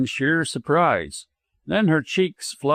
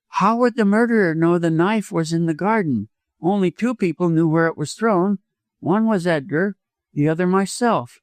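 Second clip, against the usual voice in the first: lightly voiced anxious woman, pitched higher; higher, insistent questioning from a woman under suspicion; uneasy widow; 5 semitones higher than usual. A narrator's reading. Widow interrupts doctor about the knife, uneasy.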